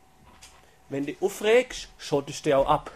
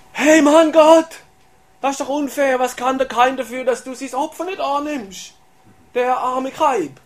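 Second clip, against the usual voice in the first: high, loud protest that it's unfair, last words slowed; indignant complaint; 8 semitones higher, 6 dB louder than usual. Retold or imitated. Believer protesting to God about Cain, high indignant complaint. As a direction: protest to God like a wronged friend.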